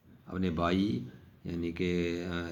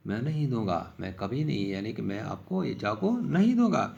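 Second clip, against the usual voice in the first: retold childish protest; 7 semitones higher than usual. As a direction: replay a small boy refusing to hand over his knife. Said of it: "میں نے ان سے کہا کہ".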